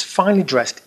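usual speaking voice

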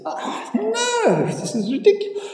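high, strained oh no; exasperated Jack. Acted. Jack losing another bet, high and exasperated. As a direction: whine it in disbelief.

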